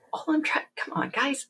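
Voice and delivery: high, soft, exasperated pleading; mock exasperated plea. Worker facing yet another login, mock exasperated.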